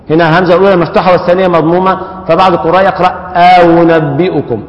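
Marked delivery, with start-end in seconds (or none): 3.4-4.6 أأنبئكم slowly, second hamza eased toward waw. Shown as a demonstration. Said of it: "بتسهيل الهمزة بينها وبين الواو".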